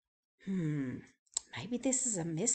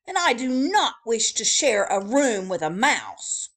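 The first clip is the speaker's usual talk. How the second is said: grumbling; 3 semitones higher, 11 dB louder than usual. Acted. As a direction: grumble it, fed up and sleepy. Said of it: "grumbled Mister Snore".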